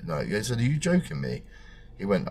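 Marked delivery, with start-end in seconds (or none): 0.6-1.4 shocked. Retold or imitated. Shocked at prognosis.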